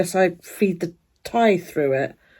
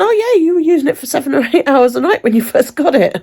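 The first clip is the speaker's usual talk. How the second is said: high, louder mocking receptionist; imitating receptionist; 8 semitones higher, 5 dB louder than usual. Receptionist reads patient her usage figures, high mock voice. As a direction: chirp it back high and smug.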